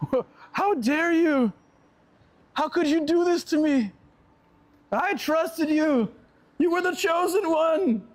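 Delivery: high, slow hammed-up melodrama; mock betrayed girlfriend. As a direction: play a wounded girlfriend over the top. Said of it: "she was so angry".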